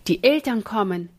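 usual speaking voice

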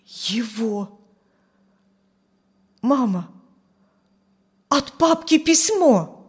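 halting, overwhelmed words to mother; breaking voice. A narrator's reading. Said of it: "сказал прерывающимся голосом". (прерывающимся от волнения голосом)

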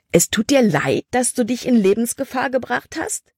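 angry retort. Acted. Caleb scolding Libby, indignant anger. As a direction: throw her apology back at her. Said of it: "Sein Gesicht war zornerfüllt".

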